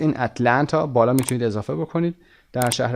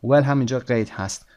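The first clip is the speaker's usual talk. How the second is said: usual speaking voice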